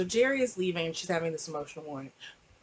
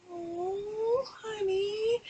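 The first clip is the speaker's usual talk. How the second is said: high, soft tearful oh honey; tearful sympathy; 12 semitones higher, 6 dB quieter, much slower than usual. Retold or imitated. Vlogger reacting to her crying in the office, high and soft. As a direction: coo through tears. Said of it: "I was like".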